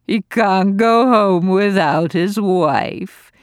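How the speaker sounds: old lady mocking the father's plea, slow and sneering; mocking mimicry. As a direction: parrot his words back with scorn. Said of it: "gloated Lady Bromley".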